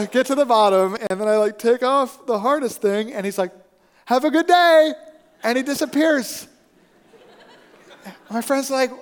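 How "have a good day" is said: high, loud imitated send-off; called farewell. Stranger leaving after the rescue, called farewell. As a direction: call it out as a parting shout.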